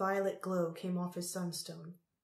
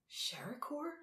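high, hushed whisper; whispered question; 8 semitones higher, 7 dB quieter than usual. A narrator's reading. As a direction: query the name under your breath. Tal asks in hushed disbelief.

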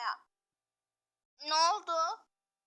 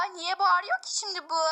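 ordinary talk

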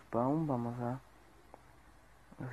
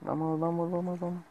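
urgent urging; 7 semitones higher than usual. Real, not acted. Urgent climb in game.

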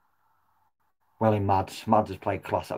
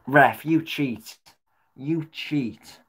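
slow bitter accusation; 4 semitones higher, much slower than usual. Real, not acted. Fan accusing referee.

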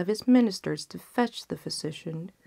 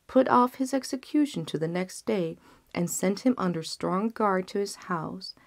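ordinary delivery, nothing marked

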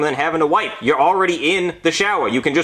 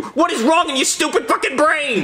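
furious self-rant. Acted. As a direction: rage at your own brain. Continues raging at himself.

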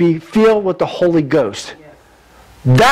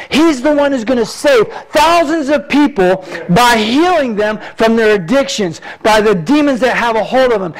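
raised, fervent preacher voice; preaching at full force; 8 semitones higher than usual. Acted. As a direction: preach it out to the congregation.

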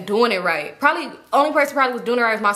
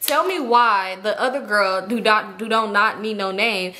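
ordinary talk, nothing marked

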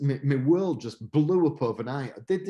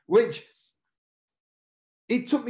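laughing; 8 semitones higher, 3 dB louder than usual. Real, not acted.

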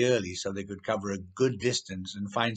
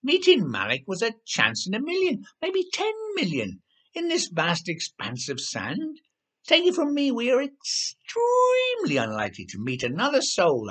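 much higher, self-important know-it-all voice; pompous writer; 12 semitones higher, 3 dB louder than usual. A narrator's reading. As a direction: lecture them as if you know best.

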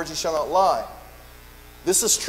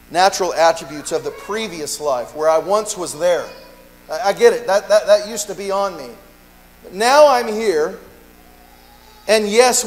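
louder, rising preacher's call; full-force preaching; 2 semitones higher, 6 dB louder than usual. Acted. Pastor testifying to his congregation, full preaching force. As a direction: preach it out to the room with fire.

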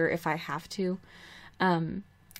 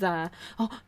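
ordinary talk, nothing marked

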